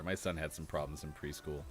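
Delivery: ordinary talk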